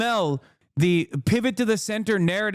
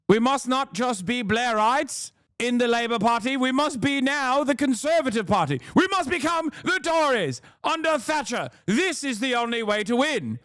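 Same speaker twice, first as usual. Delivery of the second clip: high, rousing mock posh British speech; posh British accent; 7 semitones higher than usual. Rallying the Labour Party to become Tories, hammed-up posh British. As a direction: declaim it as a posh British politician. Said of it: "posh British accent".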